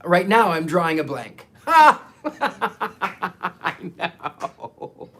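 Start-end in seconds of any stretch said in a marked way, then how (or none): 1.7-5.2 laughing. Real, not acted. Host drawing a blank on their birthday, laughing hard. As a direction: crack up at yourself.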